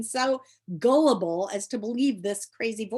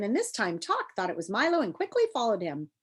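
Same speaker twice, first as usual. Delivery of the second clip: ordinary delivery, nothing marked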